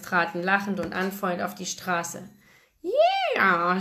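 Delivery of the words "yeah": high, drawn-out crowd whoop; loud cheer. A narrator's reading. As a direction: cheer it high, like a rowdy crowd, not full volume. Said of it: "schrien alle laut".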